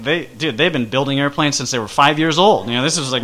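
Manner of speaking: normal talking voice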